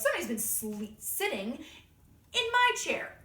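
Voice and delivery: lightly voiced indignant bear, climbing to a loud finish; Papa Bear. Papa Bear finding his chair used, indignant. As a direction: build to an outraged high end. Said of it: "Papa Bear goes".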